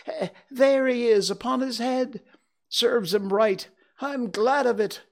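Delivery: high, excited, stammering Scrooge; between laughing and crying. A narrator's reading. Scrooge greeting his storybook heroes, wildly excited. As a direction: burst out with each new memory. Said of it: "in a most extraordinary voice, between laughing and crying".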